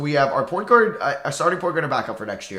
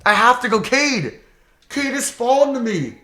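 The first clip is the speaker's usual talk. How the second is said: excited; 10 semitones higher, 6 dB louder than usual. Real, not acted. Excited about draft pick.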